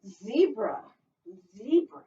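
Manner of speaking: put-on sing-song read-aloud for little kids; storytime reading. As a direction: read it like a lively picture book. Teacher reads picture book aloud.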